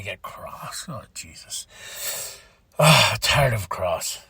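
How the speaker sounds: exasperated under-breath whisper; whispered groan. Real, not acted. Vlogger dreading Cross's return, whispered groan.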